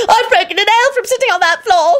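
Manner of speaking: high, upset squeal; girlish scream. A narrator's reading. Redhead girl who broke a nail, screaming. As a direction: shriek over a tiny disaster. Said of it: "screamed the redhead girl".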